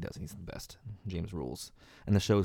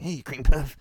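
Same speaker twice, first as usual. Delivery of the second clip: louder mock jock jeer at a nerd "Hey, cream puff"; jock taunt; 5 semitones higher, 7 dB louder, slower than usual. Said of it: "that jocks would call the nerds".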